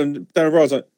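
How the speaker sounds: ordinary delivery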